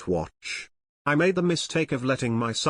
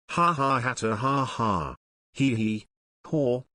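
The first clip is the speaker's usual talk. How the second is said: hammed-up laugh.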